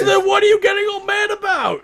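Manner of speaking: very high, exasperated question; incredulous challenge. Real, not acted. Host asking why co-host is mad, incredulous challenge. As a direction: voice leaps up in disbelief.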